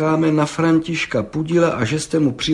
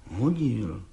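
the brickmaker mutters to the judge, softer and stubborn; stubborn mumble; 2 semitones lower, 7 dB quieter than usual. A narrator's reading. Muttered stubbornly. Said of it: "mumlal Václav Lisický s beraný tvrdohlavostí".

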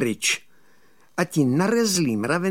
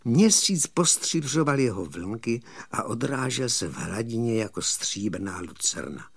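ordinary delivery

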